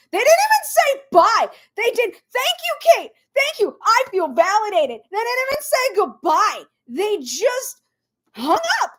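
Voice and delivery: high, loud outraged rant; indignant disbelief. Real, not acted. Minnesotan appalled by a friend hanging up, indignant disbelief. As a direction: squawk it in scandalized disbelief.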